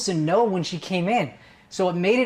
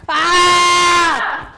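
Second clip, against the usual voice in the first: long, much higher, much louder ah shout; full-throated yell; 13 semitones higher, 9 dB louder than usual. Shown as a demonstration. Coach pumps up the student with a long loud yell. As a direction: let it all out in one long scream.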